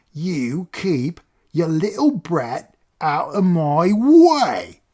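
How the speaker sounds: slow, high, rising to a loud roar; snarling threat. Acted. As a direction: threaten him, building to a yell. Driver shoving boy, threatening.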